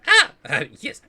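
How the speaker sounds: high, startled little yelp; flustered pixie. Acted. Pixie told to leave the room, startled high squeal. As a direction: squeak in alarm, then hurry to agree.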